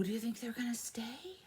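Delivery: hushed suspense whisper. Real, not acted. Teacher asking the class in a whisper.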